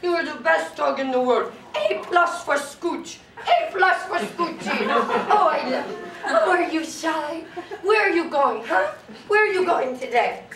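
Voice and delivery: put-on gushing baby talk to a dog. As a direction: smother the dog in silly adoration. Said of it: "that annoying dog voice".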